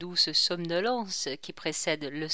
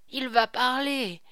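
higher, mildly agitated repeat; a touch of anxious anticipation, pitched up; 5 semitones higher, 3 dB louder than usual. A narrator's reading. King bending in bed awaits the voice, mildly agitated.

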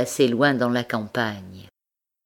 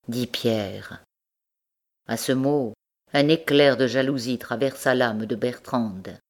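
usual speaking voice

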